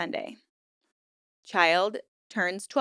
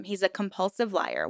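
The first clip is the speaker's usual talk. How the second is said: normal talking voice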